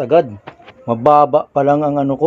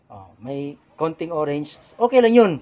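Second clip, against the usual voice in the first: ordinary talk, nothing marked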